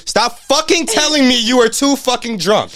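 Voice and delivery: high-pitched re-enacted angry rant; retold yelling. Recounting a row with Mila over her drinking, retold yelling. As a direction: replay your own exasperated telling-off. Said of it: "has been me yelling".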